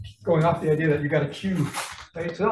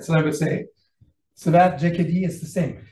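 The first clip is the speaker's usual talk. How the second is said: usual speaking voice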